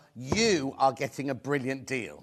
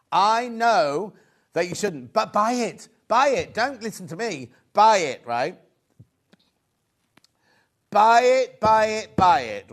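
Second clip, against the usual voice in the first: slow pleading that rises to loud, higher insistence; urgent plea building to a shout; 4 semitones higher, 8 dB louder than usual. Real, not acted. Presenter urging viewers at home to buy, building to a shout. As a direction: push harder and louder with each buy it.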